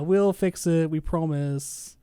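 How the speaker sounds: slow mock Canadian-accented promise. Developers promising players a fix, mock Canadian accent. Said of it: "do a Canadian accent".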